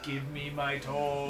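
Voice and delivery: slow, drawn-out haunting call; stretched-out spooky demand; spooky ghost voice. Acted. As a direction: moan it like a ghost demanding something back. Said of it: "it's basically Return the Slab".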